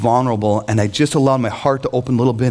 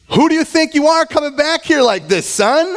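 mock scolding father; 13 semitones higher than usual. Father scolding his prodigal son, high and indignant. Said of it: "does what every good parent would do".

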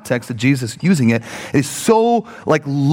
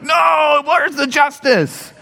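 hammed-up high indignant shout; mock outrage; 11 semitones higher, much slower than usual. Preacher mocking a driver raging over three seconds, hammed-up shout. Mock road rage outburst.

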